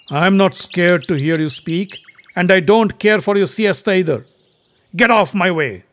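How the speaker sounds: forceful challenge to an opponent; defiant. A narrator's reading. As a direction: stand your ground, loud and high. Bhima stands his ground.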